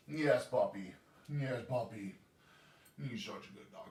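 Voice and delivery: normal talking voice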